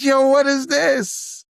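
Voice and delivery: drawn out in shock; stunned. Retold or imitated. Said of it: "I was like".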